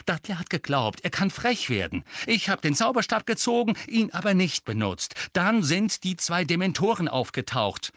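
rising high and loud in annoyance; barely held back temper; boy explains through his irritation; irritated. A narrator's reading. Harry tells angrily.

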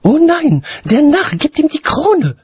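high, loud cry of dismay; alarmed lady. A narrator's reading. Lady seeing crown handed to dragon, loud alarm.

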